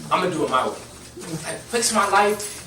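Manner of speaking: usual speaking voice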